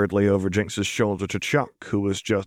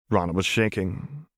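ordinary delivery

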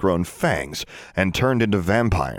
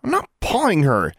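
higher, indignant denial; protesting; 9 semitones higher than usual. A narrator's reading. David protests to the twins, indignant. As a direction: protest it defensively. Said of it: "David protested".

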